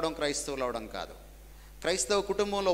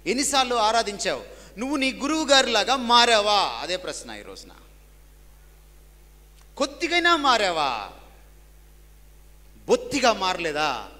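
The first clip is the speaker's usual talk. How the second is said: full-force preaching; 5 semitones higher, 7 dB louder than usual. Acted. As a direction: preach it out with rising fervour. Challenging congregation.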